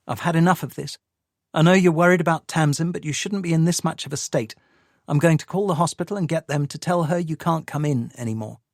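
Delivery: lightly voiced woman, quick and fed up; Sadie's voice. A narrator's reading.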